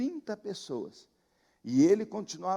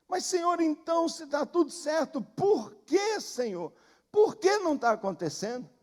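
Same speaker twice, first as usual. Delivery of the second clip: urgent pleading question; 7 semitones higher, 3 dB louder than usual. Retold or imitated. Pastor questioning God, retold.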